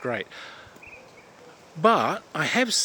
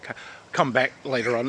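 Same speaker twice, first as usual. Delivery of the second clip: ordinary talk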